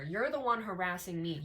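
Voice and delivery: normal talking voice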